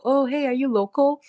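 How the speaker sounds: light high chirpy imitation of a girl asking; a quick high sing-song quote of a stranger; mock girl asking. A girl asking another if she is local, lightly mimicked.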